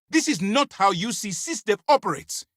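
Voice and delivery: high-pitched, forceful rebuke; commander's outraged telling-off; angry reprimand. Acted. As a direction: lay down the law to a subordinate. Commander reprimands agent for breaking rules.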